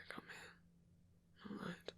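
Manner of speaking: fading, hushed whisper that guides him inward; guided meditation whisper. Acted.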